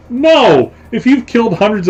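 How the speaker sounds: high, exclaimed "no" of disbelief; emphatic objection. Real, not acted. Objecting to a far-fetched TV plot, emphatic protest. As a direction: burst out in protest. Rejects the show's implausible plots.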